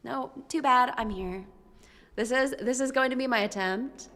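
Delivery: normal talking voice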